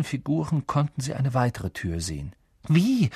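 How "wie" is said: nervous. A narrator's reading. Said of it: "sagte Hermine nervös".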